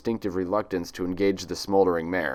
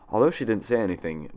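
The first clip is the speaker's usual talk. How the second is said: usual speaking voice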